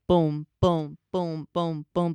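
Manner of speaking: little spoken drum pattern, evenly spaced; light imitation of a drum; drum beat imitation. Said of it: "a little cute little, little drum thing".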